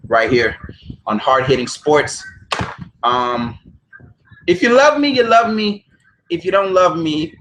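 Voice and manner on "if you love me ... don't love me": playful singsong. Pretend. Playful sign-off to viewers.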